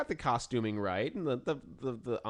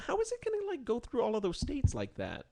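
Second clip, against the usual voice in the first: surprised; 11 semitones higher than usual. Real, not acted. Disbelief at hurricane path.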